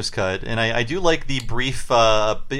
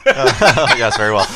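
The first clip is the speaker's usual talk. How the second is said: laughing; 3 semitones lower, 4 dB louder, much slower than usual. Real, not acted. Laughing echo of the clip.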